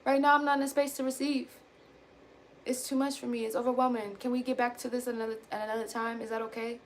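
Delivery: soft, calm request; gentle boundary. Shown as a demonstration. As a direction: set the boundary kindly and quietly. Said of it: "I don't have to say it like that".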